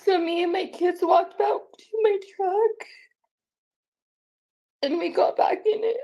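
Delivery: high, tearful telling; real tearfulness. Real, not acted. Mother recounting retreat to truck with kids, tearful. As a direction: tell it while holding back sobs. Tearful account after being threatened.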